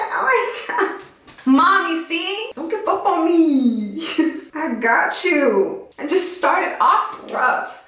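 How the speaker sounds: high, giggly words to mom; high excited plea; giddy plea. Real, not acted. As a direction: laugh while begging.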